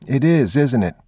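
put-on high child's voice; anxious little boy, pitched high; young boy. A narrator's reading. Boy worried about the dark, high childlike voice.

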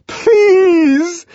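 high, loud drawn-out wail; wailing, frightened entreaty; tremulous plea. A narrator's reading. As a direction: beg desperately while being shaken. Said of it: "voice made grotesquely tremulous by his shaking".

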